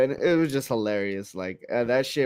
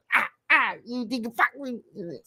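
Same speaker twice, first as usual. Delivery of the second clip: mock taunt; 11 semitones higher than usual. Hero taunting the goblins.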